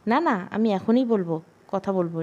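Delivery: ordinary delivery, nothing marked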